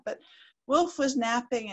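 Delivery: ordinary delivery, nothing marked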